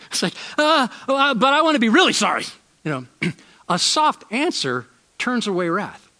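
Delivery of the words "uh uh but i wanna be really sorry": flustered mock protest. Mocking his own deflated reply.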